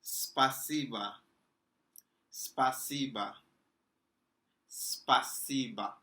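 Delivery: спасибо said slowly as spa-see-ba, falling at the end; Russian pronunciation. Shown as a demonstration. Teacher drilling спасибо for students, slow careful pron. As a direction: spell спасибо out slowly for learners. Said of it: "next word we're going to be learning".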